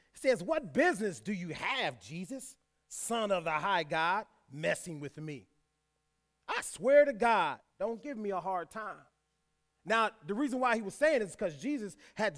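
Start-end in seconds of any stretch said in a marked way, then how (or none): none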